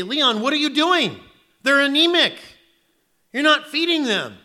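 loud, high, scolding voice of God; stern God scolding. Acted. God scolding Leon over his hungry flock, in a loud stern voice. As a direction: demand answers like an angry master.

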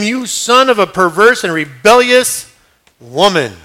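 loud, slow furious insult; angry curse. Acted. Saul cursing his son Jonathan in loud slow anger. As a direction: spit the insult out slowly. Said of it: "Saul's anger was aroused".

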